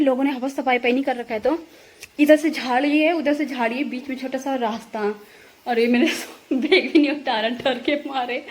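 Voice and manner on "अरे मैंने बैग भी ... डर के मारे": amused, laughing at your own fright. Real, not acted. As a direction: let a laugh break into the words. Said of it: "मुझे बहुत हंसी आ रही है".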